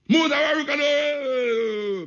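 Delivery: imitating a high, drawn-out call from the back; heckler's long call. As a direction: call out high, stretching the last sound. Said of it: "your man from the back".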